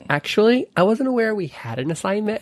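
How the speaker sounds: high, sweet naive voice; sweet Elle. Retold or imitated. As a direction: say it sweetly, clueless.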